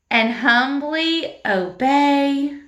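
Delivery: slow, stretched words; slow recitation. Shown as a demonstration. Teacher slowly reciting the verse with motions. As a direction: draw it out for the class. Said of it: "Do like this for obey".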